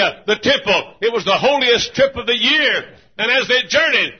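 high, loud, ringing preacher's cry; preaching at full force. Acted. Preacher to congregation on the feast trips, full-force preaching. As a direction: lift the crowd with rising fervor.

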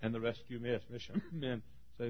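ordinary talk, nothing marked

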